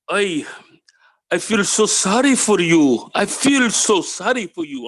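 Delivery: high, slow Indian-accented English, put on as he retells; imitated Indian accent. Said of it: "I'll try to get his accent down".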